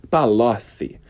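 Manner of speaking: "Palocci" with lisped c, Palothi. Shown as a demonstration. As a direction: say "Palocci" with tongue between teeth. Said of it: "É uma língua presa".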